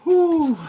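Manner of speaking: high, loud exhale of relief; big relieved whew. Real, not acted. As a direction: blow out the tension. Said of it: "That would be heartbreaking".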